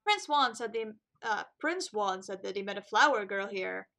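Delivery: ordinary delivery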